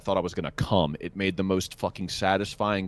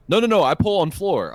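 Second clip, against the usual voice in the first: high, insistent mock Korean accent; 10 semitones higher, 5 dB louder than usual. Imitating the Korean technician refusing the frame machine, insistent accent. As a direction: play the stubborn tech waving him off, in accent.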